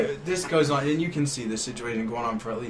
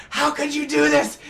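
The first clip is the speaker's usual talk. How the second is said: much higher, slow, hurt mock plea; wounded plea; 5 dB louder than usual. The pinned friend begging his captors, wounded. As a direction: ham up a betrayed victim. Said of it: "And he's like".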